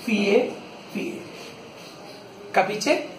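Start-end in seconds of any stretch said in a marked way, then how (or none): 0.1-1.2 Fie, fie, fie said one by one; fie counted. Shown as a demonstration. Pupil asks, teacher counts out fie. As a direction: tap out each fie. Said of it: "Enséñame los dos".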